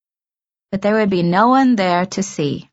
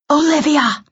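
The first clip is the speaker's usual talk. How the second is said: high, hushed call; hush shouting; 8 semitones higher than usual. A narrator's reading. Director calling from the door, hush shouting. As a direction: shout it under your breath. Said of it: "hush shouting from the door".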